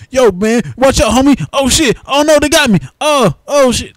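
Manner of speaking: high, loud mocking gangster voice; mock street dude. Mocking generic movie thug dialogue.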